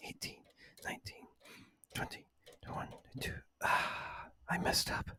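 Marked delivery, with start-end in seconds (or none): none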